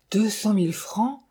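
lightly acted disbelief, rising at the end; stammering shock. A narrator's reading. Widow hearing the sum from the judge, stunned. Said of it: "bredouilla madame Montparnault tremblante de saisissement".

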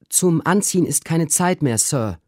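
high, urgent maid's voice; a servant girl crying out in a hurry; high breathless maid calling; Susanna calling out. A narrator's reading. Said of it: "rief Susanna".

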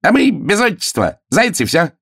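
high, merry hare call; bouncy high-pitched announcement; cheerful cry. A narrator's reading. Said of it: "и вдруг весело крикнул".

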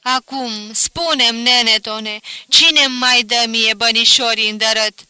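loud wailing complaint to the dead; crying out. A narrator's reading. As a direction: lament loudly, half grief half grievance. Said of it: "Se tângui mai departe".

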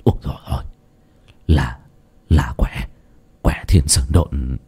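put-on low, soft, halting old man's stammer; an old man frozen by what he sees; stammering in shock. A narrator's reading. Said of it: "ông đơ cả người ra mà lắp bắp". (lắp bắp, trầm và nhỏ)

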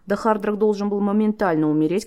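usual speaking voice

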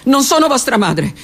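ordinary delivery

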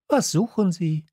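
put-on high, curious young questioner; Little Prince. A narrator's reading. Prince questions the switchman, in a high voice. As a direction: ask it high and light. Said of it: "sagte der kleine Prinz".

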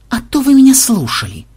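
high, peevish retort; cantankerous complaint; querulous. A narrator's reading. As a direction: snipe back crossly. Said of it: "сварливо пробурчал ксенобайт".

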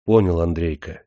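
ordinary delivery

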